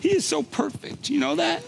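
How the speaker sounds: high, emphatic praise of the son; moved exclamation. Real, not acted. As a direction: lift it up in wonder. Sudden prophetic word to parent.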